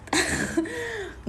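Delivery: short high-pitched giggle. Real, not acted. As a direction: giggle shyly at yourself. Laughs at own remark. (tawa kecil bernada tinggi)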